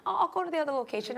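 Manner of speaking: high quoted voice. Retold or imitated. Retold friend's reply, high voice.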